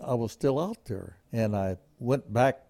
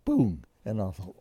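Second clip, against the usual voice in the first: usual speaking voice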